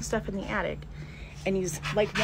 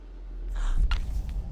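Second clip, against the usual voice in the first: gasp. Real, not acted. Frightened by noise upstairs.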